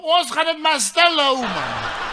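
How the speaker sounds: high, loud, drawn-out holler; shouting down. Acted. Man in the stand vows secrecy, shouting down. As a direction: bellow the secret slowly over everyone's heads. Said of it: "skree die ou daar van bo af".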